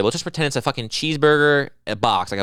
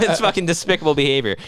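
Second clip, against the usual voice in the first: normal talking voice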